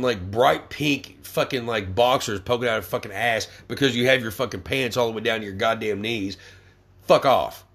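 ordinary talk

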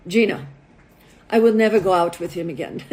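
put-on low, firm refusal in a Russian accent. Russian model rejecting a date to her matchmaker, Russian accent. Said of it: "This is my best Russian accent".

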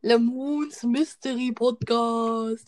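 slow, drawn-out teasing; slow teasing drawl. Real, not acted. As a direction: stretch each word out mockingly. Teasing mock name, drawn out.

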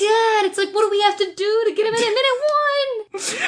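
exasperated cry. Real, not acted. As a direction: wail it like a fed-up fan. Exasperated fan complaint.

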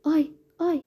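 high-pitched acted ouch; acted woman's cry of hurt; yelping in pain. A narrator's reading. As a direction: yelp as if just slapped, pitch leaping up. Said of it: "لنینا به جلو خیز برداشت و گفت".

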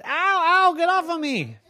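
high, loud comic ows; squashed troll complaint. Acted. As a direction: squeal it from under her weight.

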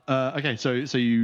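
ordinary talk, nothing marked

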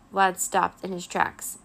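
usual speaking voice

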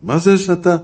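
high, loud indignant outburst; imitating an offended rabbi's yell; retold shouting. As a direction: shout at him in mock outrage. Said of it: "הוא צועק עליו".

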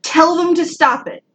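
high, insistent soapbox plea; worked-up public-service announcement; soapbox rant. Real, not acted. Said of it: "I'm off my soapbox".

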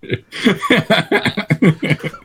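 high laughing burst; high burst of laughter. Real, not acted. Laughs at racist accent joke.

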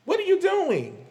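can't believe what she's seeing; a high, stunned question; incredulous. Real, not acted. Disbelief at whiskey ad.

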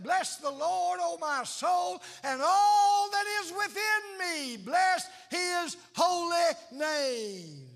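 high, loud, shouted blessing; excited shout of praise. Pretend. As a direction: burst out in joy, shouting it to God. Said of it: "so excited that you just said".

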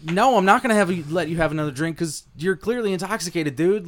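mock scolding. Bartender telling off the wasted patron. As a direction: tell him off up high. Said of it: "And I'm like".

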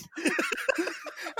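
real laughing fit; high laugh. Real, not acted. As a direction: burst of high-pitched laughter. Laughing at Mark story.

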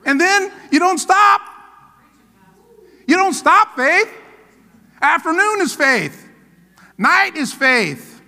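preaching at full force. Acted. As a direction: drive it home to the congregation.

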